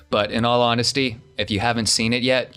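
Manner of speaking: ordinary delivery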